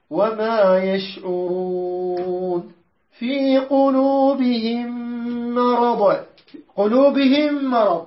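slow measured tajweed chant; Quran recitation. Shown as a demonstration. Teacher recites the verse to the class in slow tajweed. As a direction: draw each word out like tilawa.

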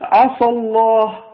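عسى with the s thickened into a heavy ص; slow, heavy ṣ in عسى, the wrong way; heavy emphatic s. Shown as a demonstration. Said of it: "بعض الذين لا يعرفون يقول".

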